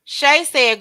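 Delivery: ordinary talk, nothing marked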